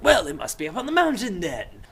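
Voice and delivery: mock insistence. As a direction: insist with exaggerated confidence. Jack insisting, acted.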